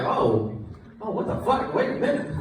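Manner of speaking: high, baffled double take; mock surprise. Classmate hearing Sammy swear, high mock surprise. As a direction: react like you can't believe your ears. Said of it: "I'm like".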